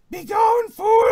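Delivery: high, loud banishing cry; a hammed-up exorcist's yell; commanding shout. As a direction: cast him out with a big high shout. GM voices Iman banishing spirit.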